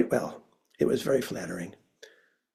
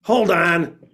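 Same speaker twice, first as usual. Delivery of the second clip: curt; 3 semitones higher, 5 dB louder than usual. Retold or imitated. Curt stranger on phone.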